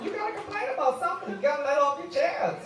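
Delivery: high, doting mama voice; Big Mama. Acted. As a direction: sweet-talk him like a big mama. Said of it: "Big Mama Got It All".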